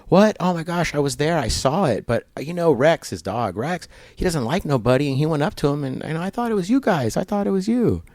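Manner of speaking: high-pitched, astonished retelling; high, incredulous imitation of a neighbor; startled neighbor. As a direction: act out a neighbor's astonished account, pitched well up. Neighbor reacting to the theft news.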